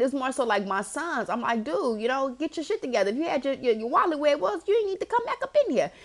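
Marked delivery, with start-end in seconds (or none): 1.6-5.9 high-pitched telling-off that keeps climbing; retold fed-up mom rant; exasperated scolding. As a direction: scold your sons, pitch rising as you go. Retold scolding of her sons.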